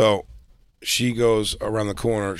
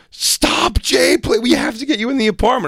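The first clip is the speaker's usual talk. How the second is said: much higher, pleading in alarm; urgent plea; 4 dB louder than usual. Retold or imitated. Jay voicing Christine's frantic text, much higher pitch. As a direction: beg him to stop, urgently.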